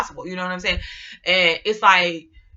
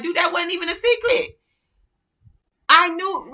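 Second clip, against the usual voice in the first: higher-pitched, lightly incredulous protest; mock incredulous; 9 semitones higher than usual. Gemini answering someone's supposed secret, mock incredulous. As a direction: act out mild disbelief, pitched up.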